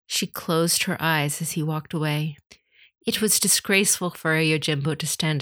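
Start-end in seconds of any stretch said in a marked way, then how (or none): none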